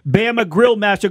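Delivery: ordinary talk, nothing marked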